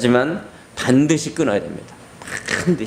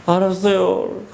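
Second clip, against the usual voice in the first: put-on high, resigned child's voice; childlike sulky yes; defeated child; 8 semitones higher, slower than usual. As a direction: give in like a beaten little kid. Child gives in.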